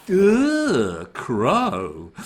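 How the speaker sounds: ordinary talk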